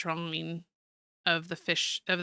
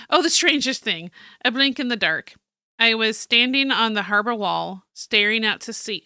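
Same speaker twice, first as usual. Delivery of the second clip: dramatic, louder journal reading; excited story narration; 3 semitones higher, 6 dB louder than usual. Acted. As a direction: open with wonder, then settle into narration. Reads journal aloud as character.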